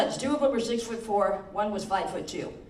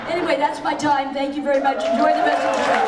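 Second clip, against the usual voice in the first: loud sign-off; 6 semitones higher, 8 dB louder than usual. Real, not acted. Comic signs off loudly.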